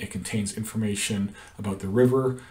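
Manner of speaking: ordinary talk, nothing marked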